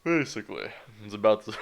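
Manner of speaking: ordinary talk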